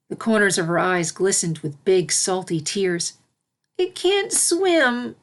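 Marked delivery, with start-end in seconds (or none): 3.8-5.2 high, very slow tearful admission; tearful filly. A narrator's reading. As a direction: confess it close to crying.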